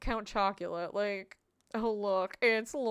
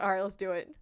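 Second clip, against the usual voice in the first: ordinary delivery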